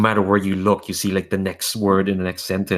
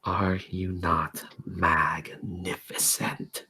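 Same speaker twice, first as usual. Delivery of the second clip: slow, seductive whisper; tempting whisper; 9 dB quieter, slower than usual. Acted. A tempting voice flattering the hero in a whisper.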